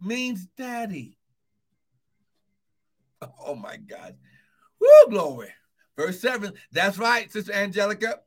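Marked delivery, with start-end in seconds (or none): 3.4-4.2 hushed aside. Real, not acted. 4.8-5.5 loud joyful whoop of glory; ecstatic shout. Real, not acted. Pastor exulting before the congregation, ecstatic shout.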